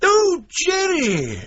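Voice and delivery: light up at the name; delighted. Acted. Professor greeting the name of his favourite student, delighted and high.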